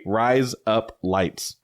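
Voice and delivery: slow, word-by-word "rise up lights" to sound Australian; Australian accent trick. Shown as a demonstration. Explaining the trick to co-host, slow Australian accent demo. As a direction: spell out the Australian-sounding phrase slowly. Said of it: "you just say".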